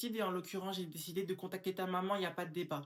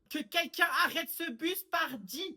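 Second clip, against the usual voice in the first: put-on high, loud, slow old-lady outcry; shrieking old lady; 9 semitones higher, 5 dB louder, slower than usual. Old woman begging someone to stop the bus, outraged. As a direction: cry out like a scandalised grandmother.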